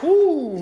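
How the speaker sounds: high, loud "woo"; celebratory whoop. Real, not acted. Builder cheering his working outlets, loud high whoop. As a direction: whoop it out in triumph.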